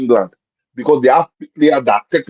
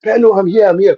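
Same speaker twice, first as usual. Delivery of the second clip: higher-pitched mock call for the ball; imitated shout; 6 semitones higher than usual. Player calling to Pirlo for the ball, imitated mild shout. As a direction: call out like a teammate, without full volume. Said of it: "he was shouting".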